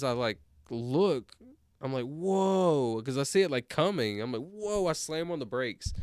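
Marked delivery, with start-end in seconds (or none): 2.1-3.0 startled whoa. Retold or imitated. Retold startle while driving. 4.4-4.9 startled whoa. Retold or imitated. Retold startle, slam brakes.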